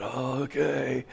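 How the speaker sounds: slow, soft, drawn-out giving in; mock-nervous okay, dragged right out; reluctant agreement.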